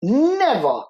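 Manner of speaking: NEVER swooping high, then falling; emphatic refusal. Real, not acted. Emphatic refusal to leave religion.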